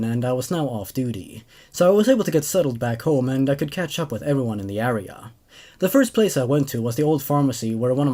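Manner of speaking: even first-person narration; story narration. A narrator's reading. Narrator telling of returning Marine, in even narration. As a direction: read it like a calm soldier's recollection. Reads second story aloud.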